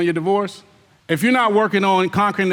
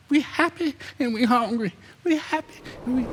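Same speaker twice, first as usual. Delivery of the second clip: higher and softer, hammed-up happy-go-lucky voice; mock carefree singsong; 5 semitones higher, 6 dB quieter than usual. Preacher mocks lazy folks happy and hungry, light singsong. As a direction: lightly parody the lollygaggers skipping along.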